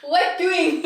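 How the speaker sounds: high, loud imitation of Dad; dad's catchphrase. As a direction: mimic Dad's greeting, pitched well up. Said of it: "That's what our dad would always say".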